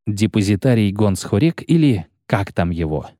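ordinary delivery, nothing marked

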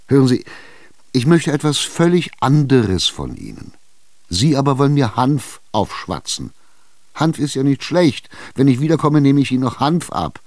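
Chichikov insisting. Acted. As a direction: corner her, push for an answer. Tschitschikow gereizt. (Tschitschikow drängt ungeduldig, höher und lauter)

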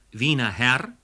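ordinary delivery

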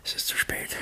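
faint unvoiced last breath; dying whisper. (ersterbendes Flüstern)

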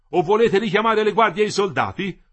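high, loud shouted question; loud, high-pitched challenge; loud heckle. A narrator's reading. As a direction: throw the question up at him. Striker shouts up at the leader.

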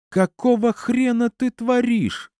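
very high-pitched, outraged yell; furious, high strained outcry. A narrator's reading. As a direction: yell it drawn out, voice way up. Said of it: "заорал я".

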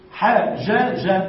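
حجج said very slowly, each letter apart; slow teaching demo of the unmerged form; slow spelled-out. Shown as a demonstration. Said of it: "سكن الأول".

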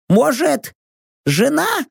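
high, questioning companion voice; Rox. A narrator's reading. Rox guesses she is his wife, high questioning character voice.

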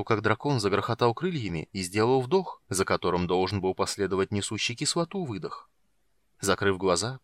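ordinary delivery, nothing marked

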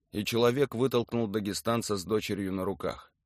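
ordinary delivery, nothing marked

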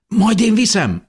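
high, loud, eager offer; urgent shout. A narrator's reading. Man offers to carry the child out, shouting. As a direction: shout it, jumping in to help. Man volunteers during emergency.

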